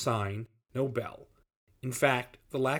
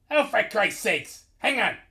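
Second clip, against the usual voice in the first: high, drawn-out exasperation; exasperated shout; 9 semitones higher, 5 dB louder than usual. A narrator's reading. Boss giving in to the interruption, exasperated. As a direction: groan it out loud, fed up.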